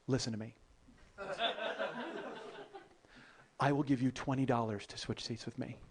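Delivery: low, soft, deliberate pitch; retold hushed deal-making; dead-serious offer. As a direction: make a grave proposition, voice dropped. Acted solemn offer to seatmate.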